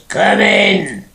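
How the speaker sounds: loud, high, rough bellow through the door; rough booming call. Retold or imitated. Sergeant bellowing at the knock on the door, loud and rough. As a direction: boom it out like a big sergeant. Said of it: "very rough voice says".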